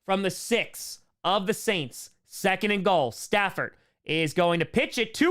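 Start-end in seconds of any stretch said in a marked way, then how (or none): none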